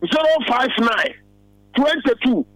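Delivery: slow, spaced-out phone number for listeners to note; slow dictation. Real, not acted. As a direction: read out digit by digit, slowly.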